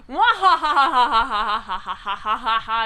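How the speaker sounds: loud, long acted evil laugh; hammed-up rolling "mwa-ha-ha"; villain laugh. Pip laughs at trapped Rocky, mock evil laughter. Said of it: "He laughs".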